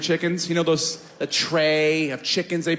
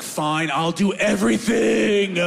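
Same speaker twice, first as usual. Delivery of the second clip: mock exasperated shout; 5 semitones higher, slower than usual. Mock huffy reply.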